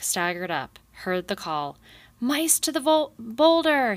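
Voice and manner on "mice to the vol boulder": high, loud rallying bellow, stumbling on vol-. A narrator's reading. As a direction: bellow the order, slip on vol- then hit boulder!. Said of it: "he bellowed".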